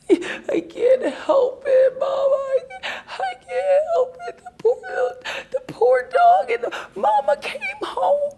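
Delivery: put-on high, sobbing little-girl plea; crying child. Child crying to her mother over a sad ad, high sob. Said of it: "just crying".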